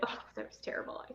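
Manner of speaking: barely voiced, breathy aside; mock disappointed mutter. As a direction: mutter it to yourself, let down. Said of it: "I'll film a video and be like".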